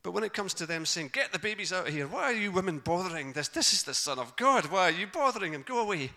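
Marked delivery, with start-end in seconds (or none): 1.1-6.1 loud, raised-pitch telling-off; cross scolding. Retold or imitated. Disciples to women bothering Jesus, acted angry scolding. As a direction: disciples loudly shooing women away from Jesus.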